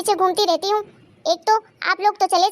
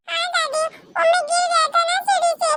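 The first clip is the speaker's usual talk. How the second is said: put-on high little boy covering up to grandma; child voice; 9 semitones higher than usual. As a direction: play a small child making an excuse. Child explains fall to grandma. (दादी से बहाना बनाता बच्चा)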